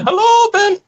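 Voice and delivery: very high, squealed hello; mock enthusiastic greeting. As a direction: greet him in a silly high-pitched voice. Acted greeting Ben supposedly gets.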